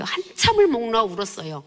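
ordinary delivery

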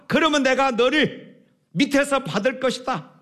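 high, powerful call from below; confident father crying out to his son; strong father's shout. A narrator's reading. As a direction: shout up with full strength. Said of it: "아빠가 힘찬 목소리로 외쳤습니다".

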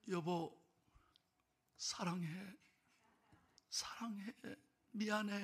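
soft, weak last words of a dying man; hushed deathbed farewell to wife; faint murmur. Retold or imitated. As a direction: barely get the words out.

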